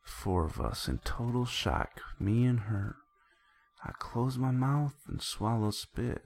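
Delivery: animated, storytelling delivery of the lines; dramatic recitation. Acted. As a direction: act out a heated exchange while narrating.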